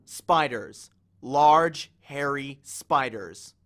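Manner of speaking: slow, weighted words, Large punched loud; ominous slow. A narrator's reading. Percy scaring Annabeth out of her trance with spiders. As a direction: drag it out to scare her.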